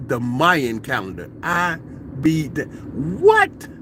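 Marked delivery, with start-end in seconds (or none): none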